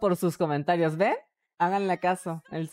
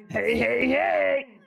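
raised, breathless hey hey hey for air; gasping hey; 7 semitones higher, much slower than usual. Shown as a demonstration. Streamer jokes her scar makes her gasp, breathless. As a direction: jokingly gasp the heys like a tic. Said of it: "Cada cierto tiempo tengo que decir".

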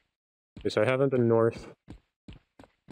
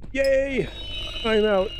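high, loud cry of relief; relieved cheer; 12 semitones higher, 3 dB louder, much slower than usual. Real, not acted. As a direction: cheer at escaping. Escaped the level.

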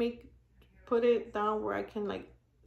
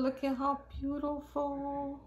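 ordinary talk

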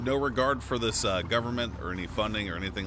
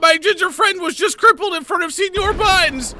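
very high, loud, frantic yell; yelled plea for help; 10 dB louder than usual. Pretend. Bystander begging help for crippled friend, frantic yell. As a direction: scream it out for the whole street. Yelling for help, louder take.

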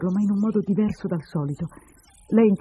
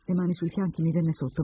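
usual speaking voice

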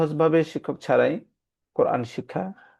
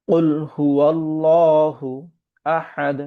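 very slow, drawn-out tajweed chant; Quran recitation; 2 semitones higher, 5 dB louder, much slower than usual. Acted. Teacher reciting the verse for the class, slow chant. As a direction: recite it slowly like a qari.

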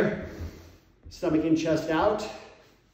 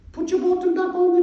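put-on very high, loud falsetto English accent; hammed-up English lady voice, Mrs Doubtfire style; mock English accent; 7 dB louder than usual. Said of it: "my English accent".

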